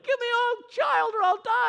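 high shrill demand in a put-on woman's voice; hammed-up high wail; mad sister. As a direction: whine it out like a desperate sister. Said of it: "the sister was mad and is like".

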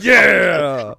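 excited cheer. Real, not acted. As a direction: shout it out in delight. Cheering the card played.

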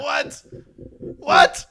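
high, loud outburst of disbelief; mock incredulous. Host reacting to the plot twist, loud mock disbelief. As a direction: exclaim it in baffled outrage, building to a shout.

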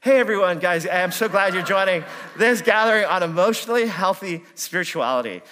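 put-on cheer. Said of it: "I'm just like".

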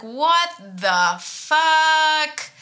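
drawn-out stunned disbelief; slow outraged what the fuck. Retold or imitated. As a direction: stretch it out, incredulous. Furious at homework grade.

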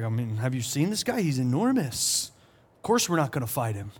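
lightly voiced brothers shrugging him off; scared brothers. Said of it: "They're like".

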